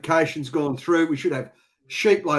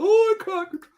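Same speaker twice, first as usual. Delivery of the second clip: much higher mock complaint; mock viewer whine; 4 dB louder than usual. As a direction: ham up a frustrated viewer. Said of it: "He's going".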